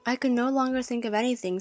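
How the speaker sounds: plain reading voice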